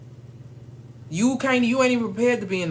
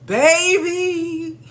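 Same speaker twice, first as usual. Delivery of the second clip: excited call; 10 semitones higher, 8 dB louder than usual. Real, not acted. Calling out excited.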